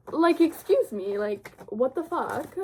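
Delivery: hammed-up offended gasp of a line; mock outrage. Gushing over the sprayed edges, mock indignant.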